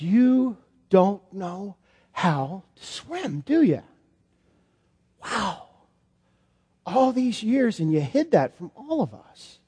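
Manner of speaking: a tender, quiet realization, drawn out; hushed amazement. Acted. Jesus gently realizing Peter can't swim.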